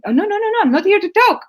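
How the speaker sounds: high, quick-fire mock refusal; retold protest. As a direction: imitate someone refusing, voice jumping up. Voices invited women refusing to talk.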